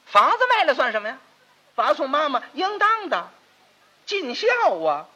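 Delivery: a lofty brush-off of the question; proud dismissal. Acted. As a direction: play it as a self-satisfied dutiful son. Second master defends selling house.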